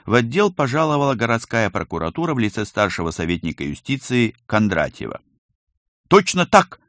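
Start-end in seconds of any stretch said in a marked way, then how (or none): 6.1-6.8 loud, high military-style acknowledgement; reporting to superior. A narrator's reading. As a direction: report smartly, raised voice. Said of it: "отрапортовал Колька". (громко отрапортовать по-военному)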